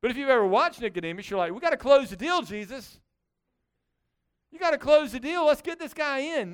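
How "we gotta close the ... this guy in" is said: high, loud, pushy pleading; pressing, raised-pitch appeal; mock urgency. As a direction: act an impatient onlooker urging action. Acts the impatient onlooker.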